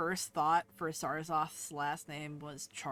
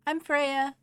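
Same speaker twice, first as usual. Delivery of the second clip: higher, louder squeaky little voice; tiny Freya; 10 semitones higher, 11 dB louder than usual. Acted. Freya pops up to be seen, higher little voice. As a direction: pop up and announce yourself small and eager.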